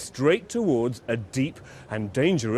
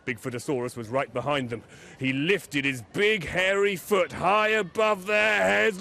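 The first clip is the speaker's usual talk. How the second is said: ordinary delivery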